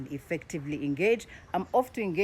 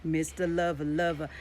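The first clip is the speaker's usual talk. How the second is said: sexy baritone; 2 semitones lower than usual. Shown as a demonstration. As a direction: purr it like a lover. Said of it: "he engaged a sexy tone in his voice".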